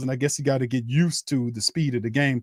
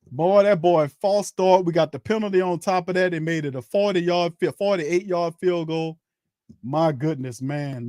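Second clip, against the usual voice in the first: ordinary talk, nothing marked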